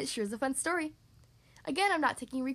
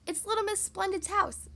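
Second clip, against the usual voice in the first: higher, eager exclamation; excited cry; 6 semitones higher than usual. Acted. Mister Tickle pointing out a house to the dragon, eager cry. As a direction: announce it with lifted pitch. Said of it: "cried Mister Tickle".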